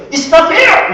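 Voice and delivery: high, exclaimed complaint in English; protesting child. Acted. Preacher voices his child complaining over unequal treatment, indignant protest. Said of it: "하진이 이렇게 얘기했어요".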